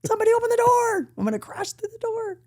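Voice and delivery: a hammed-up husband desperate to get out; mock panicked husband. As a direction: squeal it like he must flee now.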